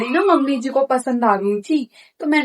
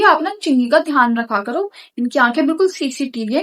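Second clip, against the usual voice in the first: ordinary talk, nothing marked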